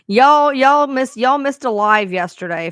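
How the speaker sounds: loud, slow call to the viewers. Real, not acted. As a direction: stretch out y'all to grab attention. Calling out to her viewers.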